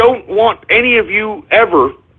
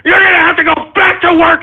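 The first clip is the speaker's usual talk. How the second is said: sudden high shout; opening yelled demand; 9 semitones higher than usual. Real, not acted. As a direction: yell it at the deserters. Ranting at ex-supporters.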